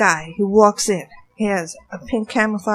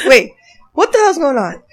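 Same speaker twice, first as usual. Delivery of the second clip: higher, louder baffled reaction; retold disbelief; 4 semitones higher, 7 dB louder than usual. Watching a stranger climb into the truck, disbelief. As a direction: exclaim it as a baffled double-take. Retelling her confused alarm.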